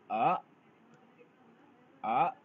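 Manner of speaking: rising tone on आ; Chinese second tone. Shown as a demonstration. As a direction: lift आ upward like a question. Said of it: "ए की pronunciation हो जाएगी".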